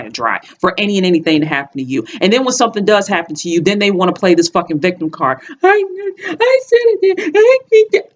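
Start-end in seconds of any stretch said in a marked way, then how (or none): 5.6-8.1 mocking whiny voice. Mocking people playing victim.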